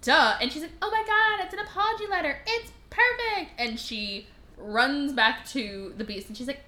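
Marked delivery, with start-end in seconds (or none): 0.8-3.5 high, delighted squeal of a quote; mock gushing. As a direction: gush over it, pitched way up. Acting out delight at the letter.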